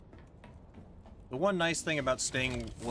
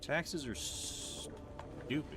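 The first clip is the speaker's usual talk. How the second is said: very slow, drawn-out grumble; whiny complaint; 10 dB quieter, much slower than usual. Pretend. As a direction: whine about it slowly. Complaining about taxes.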